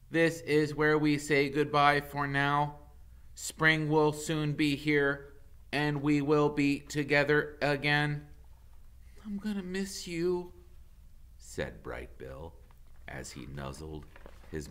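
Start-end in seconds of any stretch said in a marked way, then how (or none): 0.1-8.3 a touch of a measured robot voice; lightly voiced robot mother. Roz says goodbye. 9.2-10.5 put-on a lightly higher young gosling voice; hint of a young goose. Gosling farewell.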